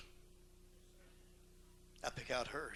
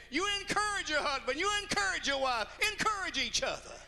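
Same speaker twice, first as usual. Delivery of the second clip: fervent preaching; 14 semitones higher than usual. Acted. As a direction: drive the word encourage home to the congregation. Preaching at full force.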